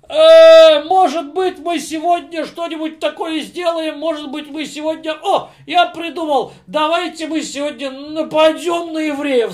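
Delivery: put-on frat bro, slurring drunk king; high, loud tipsy party boast. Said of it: "сказал он пьяным голосом".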